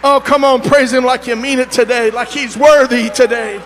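urging shout. Acted. Urging the congregation to praise.

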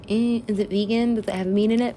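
high mock-fussy questioning; mock fussy diner. Mimics own fussy food questions.

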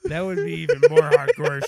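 loud, high excitement; excited outburst. Real, not acted. Reacting to the snowmobile birth story, loud and excited. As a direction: blurt it with glee.

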